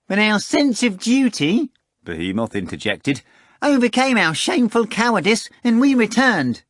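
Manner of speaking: high, loud wheedling cat voice; a fat cat bragging and wheedling; boastful cat Behemoth. Acted. Behemoth swearing to Voland, high wheedling cat voice.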